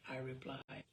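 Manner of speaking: ordinary delivery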